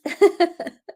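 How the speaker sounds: high laugh breaking into a cry; laughing sob. Real, not acted. Healer overwhelmed after the clearing, laughing and crying. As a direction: let the laugh tip into tears.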